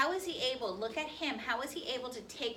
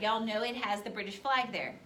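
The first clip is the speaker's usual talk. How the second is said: normal talking voice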